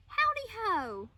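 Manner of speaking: high, loud cheery greeting; cheery hello. A narrator's reading. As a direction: bounce in with a big hello.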